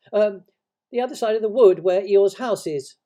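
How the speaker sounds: high, loud blurted explanation; anxious Piglet. Acted. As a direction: blurt it out in a small animal's voice.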